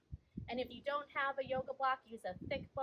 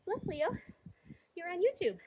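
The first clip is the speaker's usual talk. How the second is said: high, fond voice for a cat; pet talk; 7 semitones higher, 6 dB louder than usual. Real, not acted. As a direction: coo at the kitty, higher and louder. Talking fondly to her cat.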